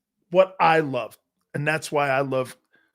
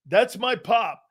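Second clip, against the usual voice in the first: normal talking voice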